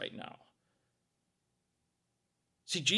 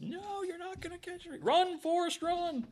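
much higher, teasing runaway voice; mock taunt; 13 semitones higher, 6 dB quieter than usual. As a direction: squeal it like dodging a chaser. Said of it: "We're just".

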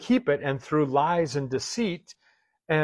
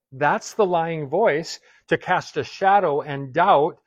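slow, louder, weighing every word; slow emphatic warning; 4 dB louder, slower than usual. Acted. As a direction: drive home the warning slowly.

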